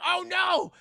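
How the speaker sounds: hammed-up shock at the collapse; mock panic. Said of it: "It's like".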